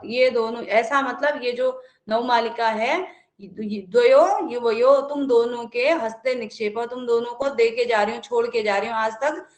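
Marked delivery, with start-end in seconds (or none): 3.4-5.0 द्वयो युवयो drawn out and louder; teacher stressing द्वयो युवयो; stressed phrase. Shown as a demonstration. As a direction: lean into द्वयो युवयो slowly. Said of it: "quoting the phrase to explain it".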